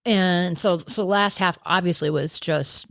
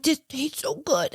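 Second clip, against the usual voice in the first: put-on toddler voice; 8 semitones higher, slower than usual. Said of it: "you're, like, two years old now".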